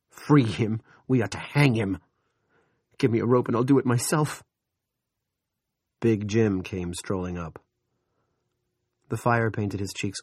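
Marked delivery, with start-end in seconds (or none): 0.1-4.4 bitter. Acted. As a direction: spit out the bitter joke. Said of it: "He grinned bitterly".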